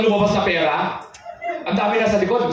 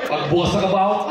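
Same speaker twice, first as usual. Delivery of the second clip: ordinary talk, nothing marked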